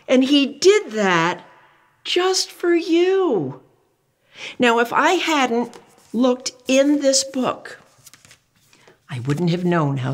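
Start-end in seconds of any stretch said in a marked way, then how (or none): 0.1-7.8 tender wonder. Acted. Tender after the reveal.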